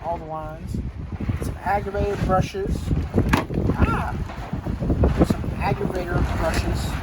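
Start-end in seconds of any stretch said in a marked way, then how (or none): 3.8-4.1 short, high "ah"; a sudden high yelp; sudden cry. Real, not acted. Showing brushes to viewers, a sudden high cry.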